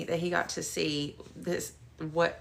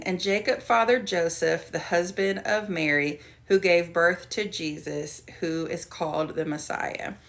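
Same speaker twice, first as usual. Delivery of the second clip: louder, measured Bible verse reading; scripture reading; 5 dB louder than usual. Shown as a demonstration. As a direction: read the verse out to the group. Reads verse sixteen aloud.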